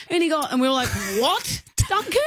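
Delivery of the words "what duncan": high, slow disbelief; incredulous. Retold or imitated. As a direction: stretch it out in disbelief. Retold disbelief at Duncan.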